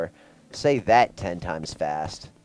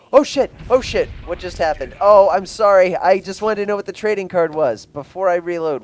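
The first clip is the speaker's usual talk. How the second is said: startled; 9 semitones higher, 9 dB louder than usual. Real, not acted. Game does something unexpected.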